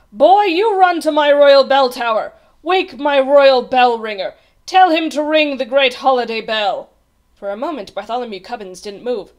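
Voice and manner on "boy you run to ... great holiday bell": loud, high royal command; imperious snapped order. A narrator's reading. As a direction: order the page boy about forcefully. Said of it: "snapped the king".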